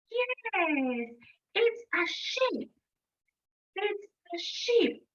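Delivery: usual speaking voice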